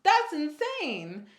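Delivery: high, loud start of a retold freak-out; kind of freaking out. Reliving sudden pregnancy by a guy just met, freaking out. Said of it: "kind of freaking out".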